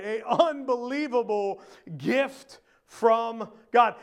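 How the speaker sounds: slow, stretched-out "unbelievable"; emphasis on "unbelievable". Shown as a demonstration. Preacher naming point four, slow stress on "unbelievable". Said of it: "unbelievable emphasis, right?".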